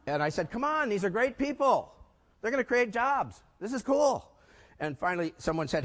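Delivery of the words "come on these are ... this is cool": higher, slower eager pitch; upbeat colleague pep talk; enthusiastic. Retold or imitated. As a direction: sell it with eager excitement.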